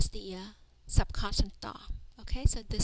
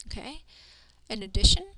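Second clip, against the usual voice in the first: usual speaking voice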